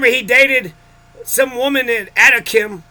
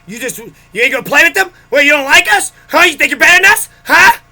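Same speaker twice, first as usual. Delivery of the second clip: rising to a shrill-free shout, louder and higher; mocking nagging mother; 4 semitones higher, 6 dB louder than usual. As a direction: voice mom's taunting, building to a yell. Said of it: "being a bigger and bigger bitch".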